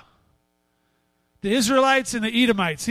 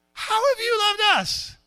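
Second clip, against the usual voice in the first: put-on high-pitched, drawn-out rebellious teen; hammed-up jaded teenager talking back; cynical teenage attitude; 9 semitones higher, 4 dB louder than usual. Shown as a demonstration. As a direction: whine it high and slow, full of attitude. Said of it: "attitudinal filled voice".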